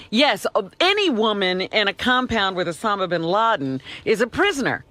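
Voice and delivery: usual speaking voice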